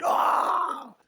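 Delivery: a sudden high yelp; short hammed-up squeal; mock shriek. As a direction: shriek out like a fan losing it. Mock outburst over [name].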